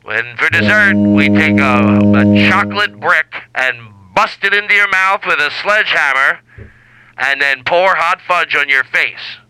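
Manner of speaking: slow, booming cruise-ship buffet ad; mock ad read. Host announces a ridiculous midnight buffet to cruise guests, booming ad voice. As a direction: sell the absurd buffet like a cheesy announcer.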